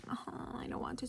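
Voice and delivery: soft, half-whispered reluctance; soft mock whine of not wanting to; reluctant groan. Vlogger retelling dread of unpacking groceries, softly reluctant.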